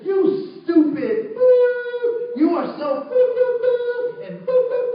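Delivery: high, loud tirade with drawn-out bleeps; angry rant imitation. Young man berating his partner at the checkout, angry rant. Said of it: "he's just going off on this lady".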